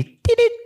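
high squeaky Siri beep; mimicked phone beep; imitated beep. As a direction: chirp it like a phone chime. Siri start beep again.

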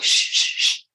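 whispered shh, shh, shh; retold shushing. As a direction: hush the kids in a whisper. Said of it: "I'm like".

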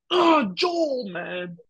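very high, drawn-out wail of dismay; hammed-up pained lament; anguished mock wail. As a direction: cry out in exaggerated heartbreak for a character. Voices a player's dismayed reaction.

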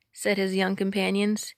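ordinary delivery, nothing marked

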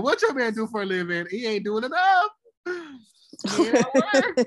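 hammed-up snooty friend; mock snob voice. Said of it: "she said".

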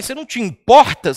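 loud, sharp reproach; accusing question. Shown as a demonstration. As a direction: throw the question like a complaint. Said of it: "quando você fala pra alguém".

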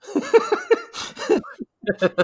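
high, loud burst of laughter; laughing. Real, not acted. Cracking up at a favourite movie line, real high laughter. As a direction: crack up uncontrollably.

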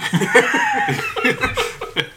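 high-pitched squealing laugh. Real, not acted. Laughs at own joke.

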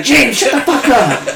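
high, slow mock snap at her husband; wife yelling at her rude husband, mock scold. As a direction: shout him down, half laughing. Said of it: "And Angie's like".

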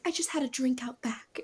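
barely voiced, feeble excuse; very soft, breath-only mock feeble voice; imitated weak whisper. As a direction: whisper it weakly, as if just coming to. Imitating a woman just passed out.

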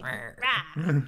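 short loud monster roar; scary roar. Acted. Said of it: "make a stance like she's really scary".

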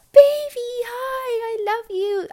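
very high, loud, gushing pet voice; baby talk to dog. Retold or imitated. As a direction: coo at your dog like he's a baby.

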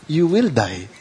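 ordinary delivery

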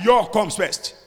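normal talking voice

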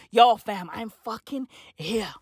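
ordinary talk

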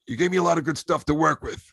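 a hint of gravel-free Pacino praise. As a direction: modest impression of the old star. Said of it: "That's my best Pacino voice".

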